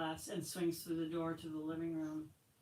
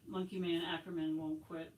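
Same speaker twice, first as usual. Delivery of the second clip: ordinary delivery, nothing marked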